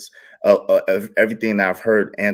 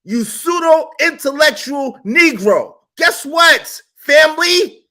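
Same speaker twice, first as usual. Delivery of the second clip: slow, high, loud insult; slow shout; 6 dB louder, slower than usual. Real, not acted. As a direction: spit each word slowly. Mocking rant at opponent.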